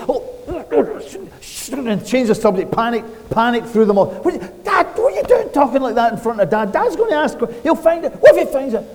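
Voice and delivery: high, fast, alarmed whispering panic; panicked brothers. Retold or imitated. Brothers hushing each other over supper, high panicked voices.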